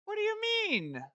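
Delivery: high, baffled cry of disbelief; baffled squeak. Real, not acted. Invalid username surprise.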